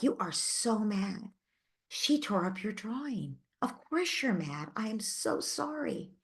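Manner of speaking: put-on gently mirror the child's anger back; soothing a child whose drawing was torn up, tenderly; empathizing. Shown as a demonstration. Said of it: "So what you're doing is empathizing".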